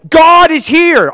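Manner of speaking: very high, loud, stretched-out cry; emphatic buzzing insistence. Acted. Answering family's lukewarm okay, emphatic buzzing insistence. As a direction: insist far harder, dragging out the words. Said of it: "they were so buzzing".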